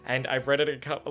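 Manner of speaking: ordinary delivery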